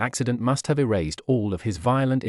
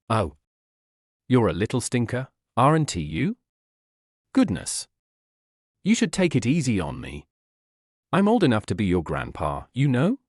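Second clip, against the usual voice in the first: mock grumble. A narrator's reading. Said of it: "Gohan grumbled while rubbing his stricken jaw".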